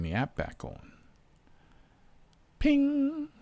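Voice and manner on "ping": a high, thin app ping; ping out like the phone app; high ping imitation.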